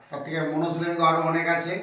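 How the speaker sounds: ordinary delivery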